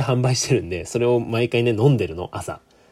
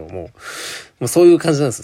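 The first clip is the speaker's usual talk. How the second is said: normal talking voice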